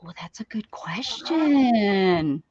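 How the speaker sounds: slow whispered wonder; awed whisper. Real, not acted. Whispering at a surprising question to viewers. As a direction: breathe it out slowly, impressed.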